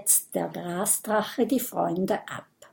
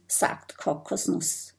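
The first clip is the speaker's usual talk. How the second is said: usual speaking voice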